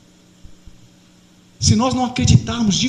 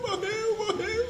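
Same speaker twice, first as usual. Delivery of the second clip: grieving sob; 11 semitones higher, 13 dB quieter, slower than usual. Acted. Marta repeats brother died.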